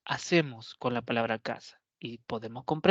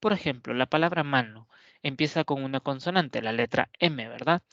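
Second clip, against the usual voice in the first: normal talking voice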